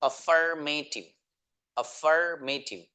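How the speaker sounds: अफर्मेटिव said slowly, each syllable clear; teacher modelling अफर्मेटिव slowly; slow spelled-out word. Shown as a demonstration. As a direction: drill अफर्मेटिव slowly for the class. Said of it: "सबसे पहला है अफर्मेटिव".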